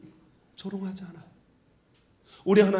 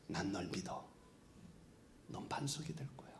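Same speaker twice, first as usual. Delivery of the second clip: soft unvoiced whisper, much softer; tender whisper; 13 semitones lower, 20 dB quieter than usual. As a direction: whisper it tenderly like God to Peter. God's tender words to Peter. (부드럽게 속삭이는 하나님의 목소리)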